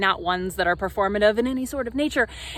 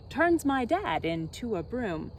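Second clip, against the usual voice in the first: plain reading voice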